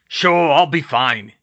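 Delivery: loud, slow big-man voice; hearty, drawn-out assurance; Hagrid, reassuring. Acted. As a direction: brush off worry heartily.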